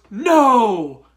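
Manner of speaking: loud, drawn-out pleading no; hammed-up cry of don't kill him; loud, slowed imitation of Layla; mock Layla plea. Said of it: "And then Layla goes".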